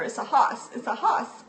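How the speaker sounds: "hoss" repeated with no R; northern R-dropping. Shown as a demonstration. Child repeating her answer to the teacher, "hoss" without R. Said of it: "over and over".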